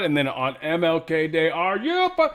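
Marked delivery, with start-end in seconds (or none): 1.5-2.3 very high, incredulous squeal; mock outrage. Host mimicking reaction to MLK Day, mock outrage. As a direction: shoot up high in disbelief, cut off mid-curse.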